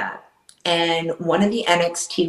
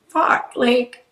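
real hurt breaking through as she swears; near tears; 9 semitones higher than usual. Real, not acted. Telling the host what her ex did, close to tears. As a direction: voice going much higher, close to crying. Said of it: "cry over someone who didn't give a shit".

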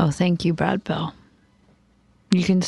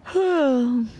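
long, high voiced sigh; high-pitched sigh of waiting; 5 semitones higher than usual. Real, not acted. Waiting alone for viewers to talk.

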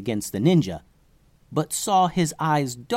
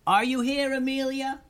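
mock distant yell; 13 semitones higher, 3 dB quieter than usual. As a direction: call into the forest without real volume. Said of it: "yelled Tubes".

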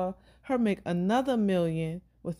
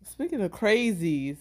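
normal talking voice

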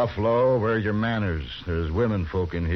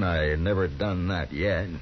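ordinary talk

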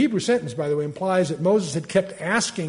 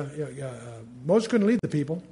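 ordinary talk, nothing marked

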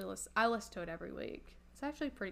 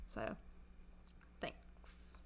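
soft whispered thanks; 12 semitones lower, 16 dB quieter than usual. Real, not acted. Whispered sign-off.

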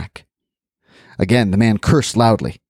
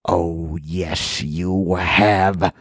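snarling; 4 semitones lower, 4 dB quieter, much slower than usual. Acted. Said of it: "snarled and said".

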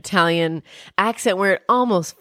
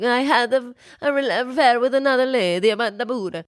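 a higher mock Italian accent; hammed-up Italian accent at confession; 5 semitones higher than usual. Husband confessing to the priest in a mock Italian accent. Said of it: "his crazy accent".

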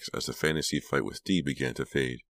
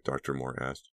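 ordinary delivery, nothing marked

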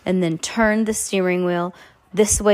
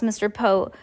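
plain reading voice

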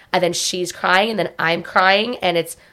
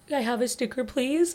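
put-on much higher, softer little-child plea; sweet toddler ask; 6 semitones higher, 10 dB quieter than usual. Toddler asking mom for a sticker, high soft plea. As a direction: imitate a toddler asking nicely. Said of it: "she's like".

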